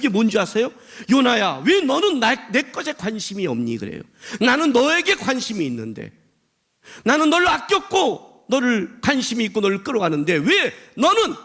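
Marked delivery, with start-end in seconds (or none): 1.1-3.8 raised, higher-pitched reproachful question; exasperated appeal. Acted. God asks Jonah why he does not care, exasperated appeal. 4.4-11.3 high, urgent reproach rising to a peak; exasperated appeal. Acted. God tells Jonah of his care, exasperated appeal.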